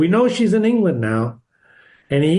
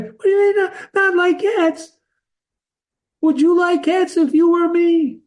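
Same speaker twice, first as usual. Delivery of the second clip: very high, piping mouse indignation; shrill, passionate; 13 semitones higher than usual. Shown as a demonstration. Offended mouse challenging the girl about cats, shrill passionate voice. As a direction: squeal it far above your usual pitch. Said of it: "That's a shrill, passionate voice".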